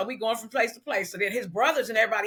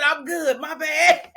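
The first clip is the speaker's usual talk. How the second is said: high, loud retold reassurance; mock apology; 5 semitones higher, 5 dB louder, slower than usual. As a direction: act out a flustered quick apology, pitched up. Sheepish reply after hitting client, retold.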